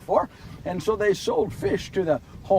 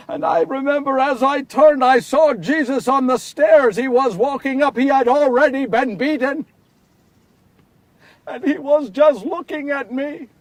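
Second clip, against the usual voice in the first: louder, higher, building grief; anguished telling; 5 semitones higher, 6 dB louder than usual. A narrator's reading. Peter recalling turning his back on Jesus: loud anguish. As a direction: pour out the remorse, rising. Climax of the betrayal story.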